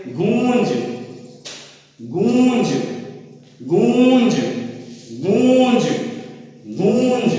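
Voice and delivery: long nasal "goooonj" for गूंज; model pronunciation of गूंज. Shown as a demonstration. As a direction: lead a repeat drill of गूंज, stretching the nasalized ऊ. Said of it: "आप भी बोल के एक बार उच्चारण करिए".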